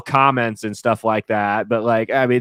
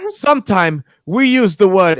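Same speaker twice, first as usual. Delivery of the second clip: higher imitation of a grandmother's broken English; 7 semitones higher, 4 dB louder than usual. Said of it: "in her broken English".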